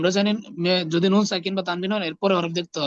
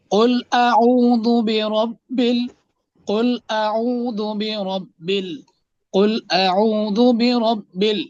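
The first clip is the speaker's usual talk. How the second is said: "কুল আউযু বিরব্বিল" drawn out syllable by syllable; slow model reading; 2 semitones higher, much slower than usual. Shown as a demonstration. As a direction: model it slowly for students to repeat. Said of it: "teacher models word joining slowly". (ধীরে ধীরে টেনে টেনে পড়ে দেখানো)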